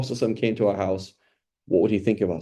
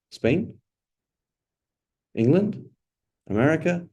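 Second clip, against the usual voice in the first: teasing quiz guesses; 6 semitones higher, slower than usual. Real, not acted. Quizzing the audience, teasing wrong guesses.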